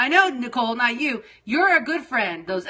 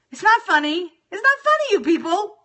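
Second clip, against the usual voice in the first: much higher and louder, scolding the viewers; upset protest; 9 semitones higher, 5 dB louder than usual. Real, not acted. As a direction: shriek it at the chat in real annoyance. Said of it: "Now I'm getting really upset".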